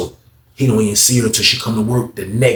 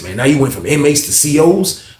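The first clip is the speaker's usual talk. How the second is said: usual speaking voice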